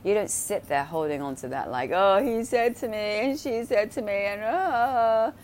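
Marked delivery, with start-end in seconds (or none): 1.9-4.4 higher, louder whiny mock of someone stuck on grievances; hammed-up moaning about who said what; mock whiny complainer. Mocking someone dwelling on grievances. 4.4-5.3 higher, louder mock-dramatic moan; a hammed-up groan of self-pity; mock sigh. Mocking someone dwelling on grievances.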